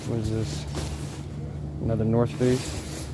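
ordinary talk

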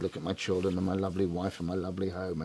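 lightly voiced sorrowful husband; mock self-pity. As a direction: sigh it out as the sad divorcee. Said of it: "telling them all about the sadness".

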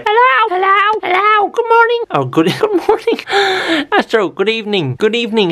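very high sing-song pet voice. Real, not acted. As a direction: coo at the puppy. Talking to the dog Astro.

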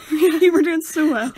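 loud, slow, laughing repeat. Real, not acted. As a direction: crack up while repeating the line. Laughing at the retold remark.

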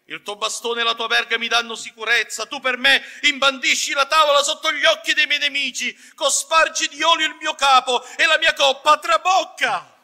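normal talking voice

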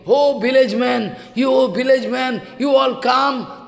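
high, loud, slow summons; shouting. Acted. Jayadev calling villagers to see the mercy, shouting. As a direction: holler to gather everyone, stretching the words. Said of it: "and shouting".

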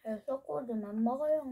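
talking between bites; chewing while talking. Real, not acted. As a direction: mouth partly full of bread. Seen 2: Holds bitten fish bread, bit just before, lips working while talking.

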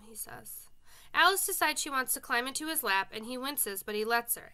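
ordinary delivery

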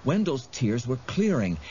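ordinary delivery, nothing marked

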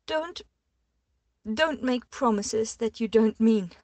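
high, loud, tearful protest; pulling away from him, tearful and loud; distraught plea. A narrator's reading. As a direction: push him away with the words. She pulls away, distraught.

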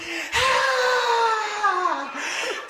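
loud high gasping wheeze for air; gasping breath. Retold or imitated. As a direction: gasp like he's been drowning. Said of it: "He was breathing like this".

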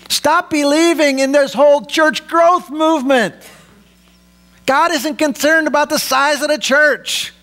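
high, insistent sermon delivery; forceful preaching. Real, not acted. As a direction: preach it as an urgent correction. Preaches forcefully against false teaching.

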